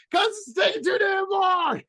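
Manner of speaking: high, crying shout of frustration; whiny angry shout. Retold or imitated. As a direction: wail it like a tantrum. Retold angry reply in line.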